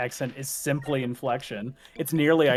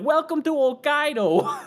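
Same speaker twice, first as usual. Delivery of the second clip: higher, slow, drawn-out Minnesota welcome; Minnesota accent; 13 semitones higher, 5 dB louder, slower than usual. Retold or imitated. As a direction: greet them in a hammed-up Minnesota accent. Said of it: "it's just ridiculous to be like".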